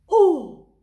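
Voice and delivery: loud, high amazed gasp; astonished oh. Acted. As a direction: burst out in wonder. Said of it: "s'étonne le monstre".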